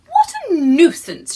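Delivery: high, loud cross little voice; annoyed little woman. Acted. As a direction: huff it out like a tiny fed-up woman. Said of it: "she said".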